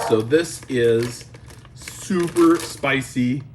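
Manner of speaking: drawn out, slow; slow dramatic reveal. Acted. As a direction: stretch each word for drama.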